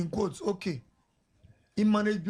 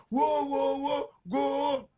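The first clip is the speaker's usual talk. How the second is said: imitated crowd cheer; 5 semitones higher, much slower than usual.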